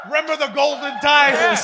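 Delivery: loud, high-pitched mock impression; imitating a friend, pushed high and loud; comic impression. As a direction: belt it out as a hammed-up imitation. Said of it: "I've never done an impression of you".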